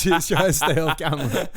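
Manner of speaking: high, loud laughing delivery. Real, not acted. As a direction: talk through bursts of laughter. Laughing at the cashier's shyness.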